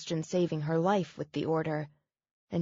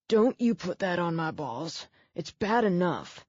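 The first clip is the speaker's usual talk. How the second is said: gruff man voice. Acted. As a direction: growl it through the pain. Injured man warning her off.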